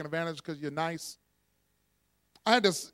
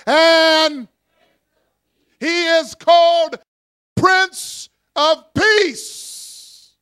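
slow, building shout rising higher to the end; the right way to shout the names; proclaiming the titles; 6 semitones higher, 11 dB louder, much slower than usual. Shown as a demonstration. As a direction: thunder each title of Jesus, building. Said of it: "You gotta go".

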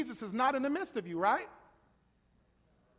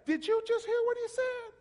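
much higher, scandalized Pharisee gasp; mock-outraged Pharisee pitched way up; indignant Pharisee; 11 semitones higher than usual. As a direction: play the shocked naysayer. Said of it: "they're gonna look and say".

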